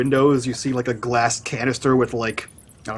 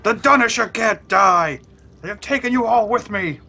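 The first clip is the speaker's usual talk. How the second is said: defiant villain; 8 semitones higher than usual. Acted. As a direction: cry out a last stand in character. Villain's defiant last stand.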